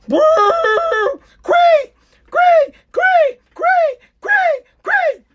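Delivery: very high, loud falsetto whoop of "great"; mock cheer. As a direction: squeal it out way up high like a fake celebration. Mock cheer after laughing fit.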